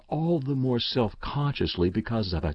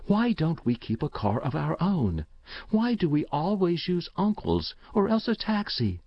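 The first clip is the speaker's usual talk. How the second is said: put-on high-pitched child asking mother why; questioning young boy; 7 semitones higher than usual. A narrator's reading. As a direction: pitch it up as a puzzled little boy.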